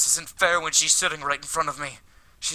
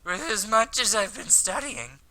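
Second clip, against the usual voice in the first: put-on drunk-sounding guy; 6 semitones higher than usual. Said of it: "I don't like this voice for him".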